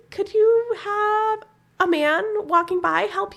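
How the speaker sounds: a hint of a sweet old grandma; imitating grandma. Imitating grandma telling granddaughter to flag a man.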